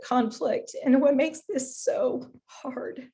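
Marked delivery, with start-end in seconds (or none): 1.8-3.1 barely getting the words out; whispered through tears. Real, not acted. Mother of a young daughter, choking up in a whisper. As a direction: voice dropping to a tearful whisper. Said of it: "I didn't wanna get emotional".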